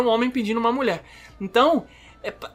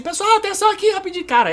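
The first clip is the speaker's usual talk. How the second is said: much higher voice calling everyone to attention; calling the crowd; 11 semitones higher than usual. Retold or imitated. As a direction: imitate someone rounding people up for a photo. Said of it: "E fala assim".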